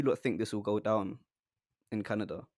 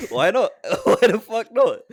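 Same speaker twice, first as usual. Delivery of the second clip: laughing; 11 semitones higher, 12 dB louder than usual. Real, not acted. Laughing, loud, playful.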